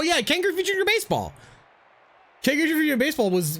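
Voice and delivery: usual speaking voice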